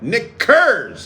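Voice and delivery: Knickers with a crisp, clearly sounded K; hard K in Knickers. Shown as a demonstration. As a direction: punch the K at the start of Knickers. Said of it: "Say it like that".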